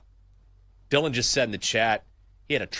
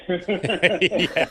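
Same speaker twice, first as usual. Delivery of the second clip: laughing yeah; 7 semitones higher, 5 dB louder than usual. Real, not acted. Laughs at guest's joke.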